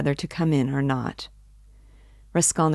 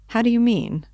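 sharply higher, baffled question; puzzled surprise; 7 semitones higher, 4 dB louder than usual. Acted. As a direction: ask it startled and puzzled. Razumihin puzzled by odd question.

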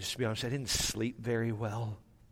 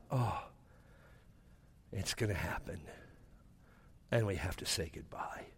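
low, hushed, halting sadness; dreading goodbye; 7 semitones lower, 11 dB quieter than usual. Retold or imitated. Dad realises he must say goodbye, low hushed dread. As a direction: sink into near-whisper with long pauses.

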